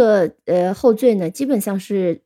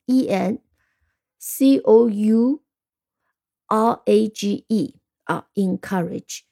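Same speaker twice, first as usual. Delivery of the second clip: slow, with pauses between "E N", "C O U", "R A G E"; spelled by syllables; slower than usual. Shown as a demonstration. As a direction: pause after each syllable group. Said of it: "或者是用三个音节啊".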